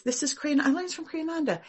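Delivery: usual speaking voice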